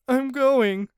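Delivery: high, slow, hurting plea; pained and scared. Acted. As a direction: whimper it out in pain. Anthony cries out in pain.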